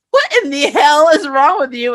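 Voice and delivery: high, loud mock exasperation; lightly voiced Claire snapping in disbelief; exasperated Claire. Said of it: "Claire gives him this look like".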